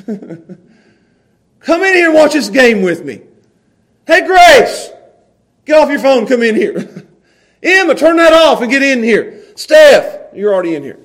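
put-on loud, high, slow call to the kids; calling the family in. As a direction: shout them in from other rooms. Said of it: "I hollered".

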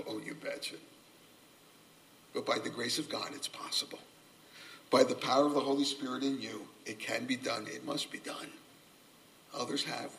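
soft, low and tender; quiet encouragement. Acted. Preacher softly encouraging the congregation it can be done.

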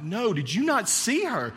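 high, slow incredulous question; mock disbelief. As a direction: ask it as if he's blind to the danger. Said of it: "They're like".

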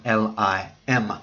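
Lim spelled slowly, L I M, each letter apart; slow spelling of the surname Lim; careful spelling. Shown as a demonstration. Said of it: "Let me have it spelled correctly now".

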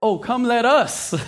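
a playful mock of the crowd; mocking echo. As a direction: teasingly parrot their words. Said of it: "what does God say?".

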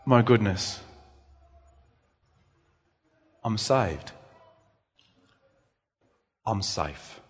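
a believer alone in their room, awestruck murmur; awed hush. Pretend. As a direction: marvel quietly to yourself. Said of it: "and just going".

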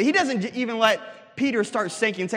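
ordinary talk, nothing marked

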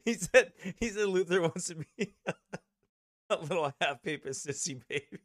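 laughing while talking. Real, not acted. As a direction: giggle through the words. Reactor laughs while quoting.

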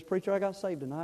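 ordinary talk

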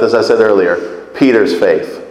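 ordinary talk, nothing marked

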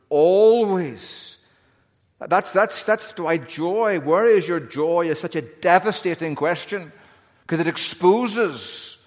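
loud, high impassioned preaching; preaching at full force. Acted. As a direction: build to a fiery sermon peak. Preaching climax rising.

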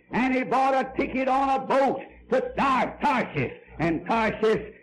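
loud, driving pulpit storytelling; full-force preaching. Real, not acted. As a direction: preach it out at full volume to the crowd.

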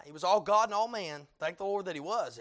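normal talking voice

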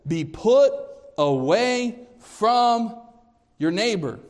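high, loud and slow on put away; preacher ringing out the verse; emphatic. Acted. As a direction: proclaim it with force. Preacher quoting verse with force.

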